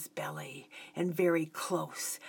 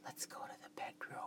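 quick hushed whisper; 18 dB quieter, faster than usual. A narrator's reading. Woman reaching for his hand, whispered invitation. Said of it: "and whispered".

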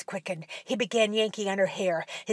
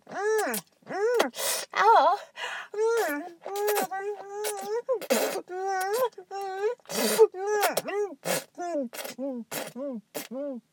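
moaning; 10 semitones higher, much slower than usual. Acted. Mother moaning during sex.

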